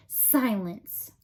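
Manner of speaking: plain reading voice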